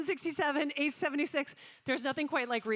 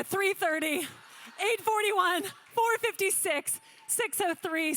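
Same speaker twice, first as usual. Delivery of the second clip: rapid, rhythmic auction calling of paddle numbers; auctioneer calling; 6 semitones higher than usual. Acted. Auctioneer to gala room, calling paddles in rhythmic auction patter. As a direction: rattle off the bids like a live auctioneer.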